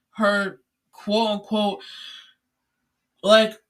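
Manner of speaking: words stretched out by a yawn. Real, not acted. Vlogger mid-rant, caught by a yawn, drawn out. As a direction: yawn right through the words. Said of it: "I kinda yawned there a little bit".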